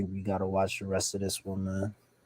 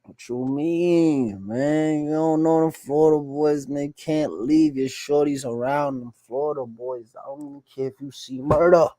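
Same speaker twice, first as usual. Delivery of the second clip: mock outburst; 4 semitones higher, 5 dB louder than usual. Warning a man about them Florida boys, mock outburst. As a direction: holler it like a playful warning.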